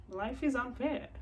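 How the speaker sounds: high, sing-out retort; retold breezy dismissal; flippant comeback.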